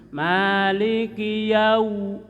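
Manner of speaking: Maliki yau slow, lips pushed forward on yau; rounded yau. Shown as a demonstration. Teacher shows the rounded wau to students.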